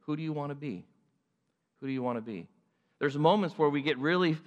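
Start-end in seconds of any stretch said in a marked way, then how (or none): none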